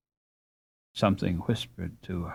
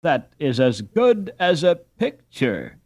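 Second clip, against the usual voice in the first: slow, raised, admiring exclamation; admiring cry; 6 semitones higher, 4 dB louder, slower than usual. Acted. Dame Brinker admiring the children, crying out in delight. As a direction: gush over the pretty group. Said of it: "cried Dame Brinker".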